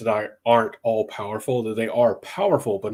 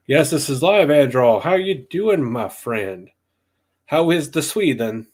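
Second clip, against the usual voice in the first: playful put-on Swedish accent, higher; light Swedish accent, higher and louder; mock Swedish accent; 4 semitones higher, 5 dB louder than usual. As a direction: greet the Swedish viewer in a hammed-up Swedish accent. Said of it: "I just threw that accent on there".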